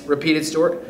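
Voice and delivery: usual speaking voice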